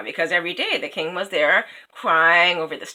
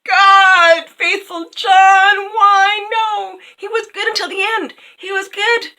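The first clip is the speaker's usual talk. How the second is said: high, loud hammed-up sobbing lament; mock wailing grief; 11 semitones higher, 5 dB louder than usual. The crowd mock-wailing over Faithful John turned to stone. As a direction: wail it like an over-the-top mourner. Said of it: "everyone was crying".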